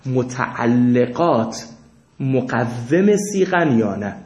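spelled out slowly, each word weighed; slow it down so students catch متعلقات and مقوم; slow emphatic question. Shown as a demonstration. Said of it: "teacher repeats question slowly". (آهسته و شمرده، با تأکید)

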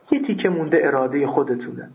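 ordinary delivery

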